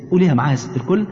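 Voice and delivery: normal talking voice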